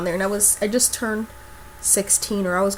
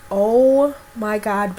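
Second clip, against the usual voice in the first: stunned; much slower than usual. Retold or imitated. Walking out to the strangers.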